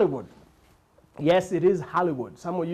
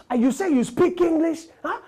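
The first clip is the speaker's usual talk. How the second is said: put-on high, louder Nigerian-accented scolding; mock Nigerian indignation; 8 semitones higher, 3 dB louder than usual. Nigerian guest scolding the clerk, high accented indignation. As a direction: scold him for not knowing English.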